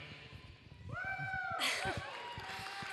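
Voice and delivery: giddy shrieking laugh; high squealing laugh. Real, not acted. Speaker steps up, laughs loudly.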